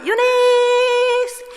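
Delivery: high, slow drawn-out call of the name; children calling. Retold or imitated. Sunday school kids call their teacher sweetly, drawn out.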